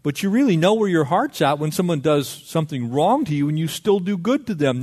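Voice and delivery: ordinary talk, nothing marked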